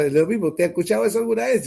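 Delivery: ordinary delivery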